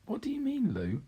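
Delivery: high, puzzled question. Acted. Peter asking Lucy, lightly puzzled. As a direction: ask gently, confused.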